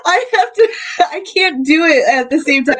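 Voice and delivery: words through laughter; laughing. Real, not acted. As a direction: crack up mid-sentence. Laughing while talking.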